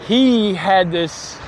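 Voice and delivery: normal talking voice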